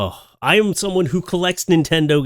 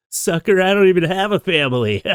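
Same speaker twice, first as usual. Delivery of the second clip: sly gloating cat; 6 semitones higher, 4 dB louder than usual. Acted. Cat gloats after getting food.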